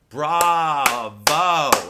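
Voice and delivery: loud mock cheer; sarcastic bravo. Real, not acted. Jeering at the AI comedy show, loud sarcastic cheer. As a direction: applaud with contempt.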